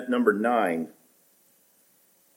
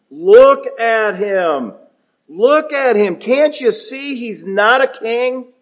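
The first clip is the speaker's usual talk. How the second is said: much higher, slow, pleading Pilate voice; 13 semitones higher, 7 dB louder, slower than usual. Retold or imitated. Pilate showing Jesus to the crowd, high, slow pleading voice. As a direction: Pilate begging the crowd to look at the beaten man. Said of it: "That's the sense".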